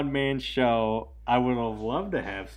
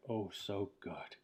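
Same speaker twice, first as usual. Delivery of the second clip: quiet acted reply; 11 dB quieter than usual. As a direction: murmur it slowly, imitating his reply. Acting out character's cake reply.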